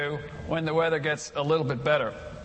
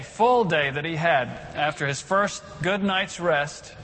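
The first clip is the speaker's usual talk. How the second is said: usual speaking voice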